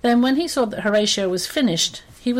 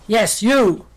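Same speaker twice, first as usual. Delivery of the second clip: loud, commanding lion voice; lion's roar; 7 dB louder than usual. Acted. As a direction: roar it at the little mouse. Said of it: "roared the lion".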